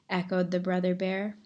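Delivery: ordinary delivery, nothing marked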